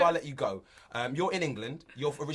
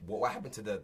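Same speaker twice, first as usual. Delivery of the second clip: ordinary delivery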